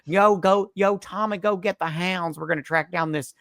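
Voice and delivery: high, hammed-up urgent orders; mock posse leader. Old-time lawman ordering out hounds, mock urgency. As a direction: send men off on a manhunt, jokingly.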